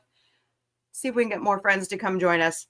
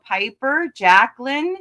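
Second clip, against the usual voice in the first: normal talking voice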